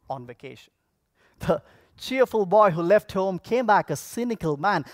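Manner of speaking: ordinary delivery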